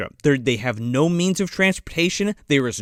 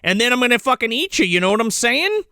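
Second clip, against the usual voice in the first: swaggering imitated ogre, pitched up; mock sadist ogre; 6 semitones higher than usual. Ogre ordering kids to clean, gloating mock swagger. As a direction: relish each threat in a hammed-up voice. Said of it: "this ogre guy's a fucking sadist".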